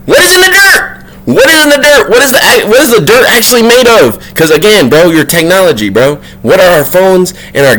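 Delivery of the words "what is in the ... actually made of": excited insistence. Real, not acted. Vlogger pushing viewers to think about dirt, insistent. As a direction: press the question high, over and over. Pressing rhetorical question on viewers.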